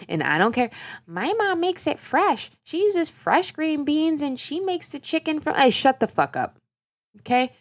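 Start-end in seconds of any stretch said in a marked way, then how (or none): 1.1-5.5 high, whiny mock voice of a green bean defender. Mocking imagined green bean defender. 5.5-7.5 mock snap. Snaps at imagined objector.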